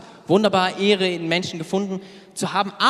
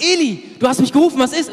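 put-on eager boy; 8 semitones higher, 5 dB louder, faster than usual. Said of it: "und fragt".

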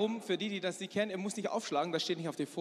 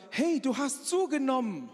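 blunt exclamation; 6 semitones higher than usual. Retold or imitated. Friend greets the speaker's wife in Malaysia, blunt exclamation. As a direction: call it out with tactless directness.

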